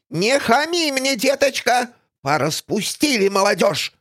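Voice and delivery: high, cross scolding voice; scolding villainess. Acted. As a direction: scold like a grumpy old crone. (ворчливая злодейка)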